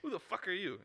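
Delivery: high-pitched mock indignation; confrontational movie quote. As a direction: challenge a stranger in an acted quote. Acts out confrontation from film.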